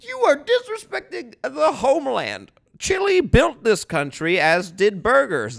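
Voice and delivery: high, loud, slow hammed-up indignation; mock patriotic outrage. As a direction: defend the homeland grandly.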